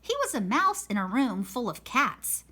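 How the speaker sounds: plain reading voice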